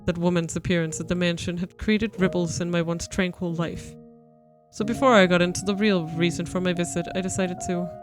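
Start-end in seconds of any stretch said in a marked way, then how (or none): none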